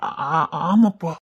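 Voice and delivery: slow, stuttering confirmation; nervous stammer. Acted. Son lying to his suspicious father, stammering nervously. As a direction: stumble over it like a caught liar.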